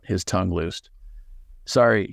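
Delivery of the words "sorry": ordinary delivery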